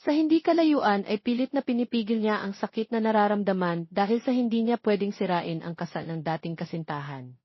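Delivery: ordinary delivery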